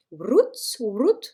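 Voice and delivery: "root" said twice, drawn out with a cooing r and oo; French speaker trying "root" slowly, cooing it; cooing English word. Real, not acted. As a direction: draw out "root" like a pigeon's coo, unsure of it. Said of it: "j'ai l'impression de roucouler".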